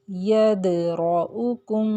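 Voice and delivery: यदरउकुम very slow, the द bounced; qalqala on dal. Shown as a demonstration. Teacher slowly reciting the dal example. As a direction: drag out यदरउकुम with a jolt on द. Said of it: "दाल की मिसाल".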